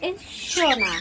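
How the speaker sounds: ordinary delivery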